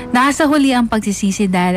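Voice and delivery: ordinary talk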